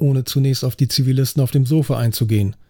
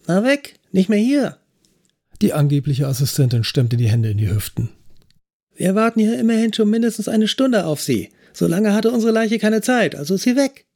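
higher, perky voice of the pierced trainee; cheeky young officer; 8 semitones higher than usual. A narrator's reading. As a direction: play the cocky trainee introducing herself. Trainee explains the missing body.

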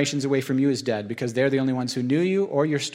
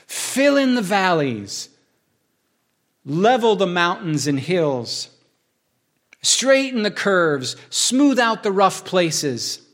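slow, raised reading of each clause; first proclaimed reading; 5 semitones higher, 4 dB louder, much slower than usual. Acted. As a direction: read it out slowly, clause by clause.

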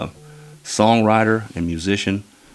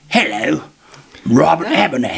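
loud, higher mock Cockney greeting; Cockney accent; 6 semitones higher, 5 dB louder than usual. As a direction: belt out a hammed-up Cockney introduction. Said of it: "gonna come in with some cock".

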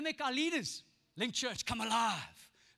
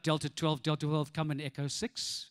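much lower radio operator; radio call voice; 8 semitones lower than usual. Retold or imitated. Boy calling his farmer friend on radio. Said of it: "So I'd go like".

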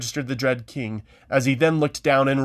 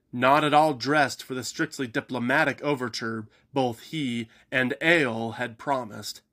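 plain reading voice

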